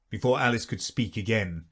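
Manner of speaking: ordinary delivery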